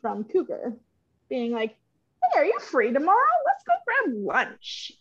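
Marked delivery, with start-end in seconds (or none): 2.2-4.9 lilting, high mock invite; chirpy lunch invite. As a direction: mimic an over-friendly texter, pitched up. Voices the cougar's lunch invitation.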